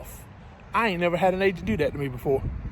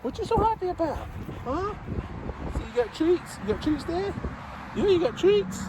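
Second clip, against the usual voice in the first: much higher, soft sing-song pet voice; 10 semitones higher, 4 dB quieter than usual. Real, not acted. Trucker cooing to his dog about its treats, high baby talk. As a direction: coo over the treats.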